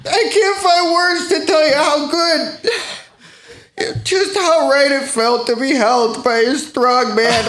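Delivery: high, cracking-up emotional confession; hammed-up weepy pleading; tearful outpouring. Guest tells host of being held by a man, tearfully.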